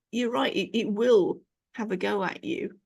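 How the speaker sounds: normal talking voice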